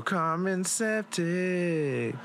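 very slow, hammed-up title drop; drawn-out name. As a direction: stretch the name out for laughs. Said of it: "she had to take a poo-poo".

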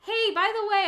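high, loud call to another room; shouting over the shower. Retold or imitated. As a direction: call out through the bathroom door. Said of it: "in the shower shouting him".

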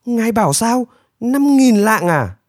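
high, stunned questioning; shocked high-voiced echo; aghast disbelief. A narrator's reading. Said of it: "Nanpu lác mắt".

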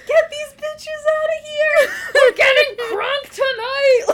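high, loud, drawn-out whoop through laughter; hammed-up party shout. Imitating reservation kids celebrating, hammed-up party shout. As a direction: holler it like a rowdy reveller, cracking up.

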